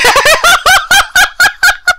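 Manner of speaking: high, loud burst of laughter; helpless high laugh after a scare; real pealing laughter; laughing fit. Real, not acted.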